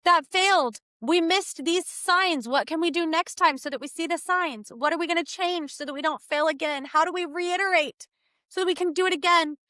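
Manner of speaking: lightly voiced parent lifting a child up; gentle coaching parent. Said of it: "and say".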